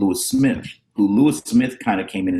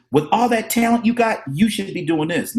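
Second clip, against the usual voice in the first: encouraging mentor; 8 semitones higher than usual. Retold or imitated. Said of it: "the way he would say it is".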